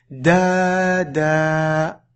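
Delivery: "da, da" with both vowels held long; long vowels in rhythm. Shown as a demonstration. Teacher reading in rhythm, "da, da" slowly drawn out. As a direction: sustain each a in "da, da", evenly timed. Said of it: "ce rythme-là".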